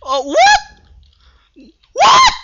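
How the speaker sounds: shrieking high what; shocked disbelief. Real, not acted. Gamer just got blown up, shrieking disbelief.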